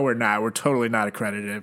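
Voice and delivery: ordinary talk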